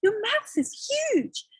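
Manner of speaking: put-on a girl staring at grandma's mouth; girl's high voice.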